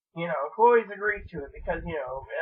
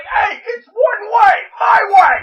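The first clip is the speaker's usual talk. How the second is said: loud, high, slow yell in a mock British accent; hammed-up British cab driver bellowing the line; British accent shout; 17 dB louder, slower than usual. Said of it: "And British accent follows me".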